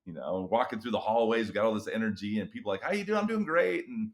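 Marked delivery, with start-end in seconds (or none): 2.8-3.2 bright, high hallway hello; cheery greeting. Retold or imitated. August hallway greeting, contrasted with later months. 3.2-3.9 high, chipper answer; upbeat reply. Retold or imitated. August reply, full of energy.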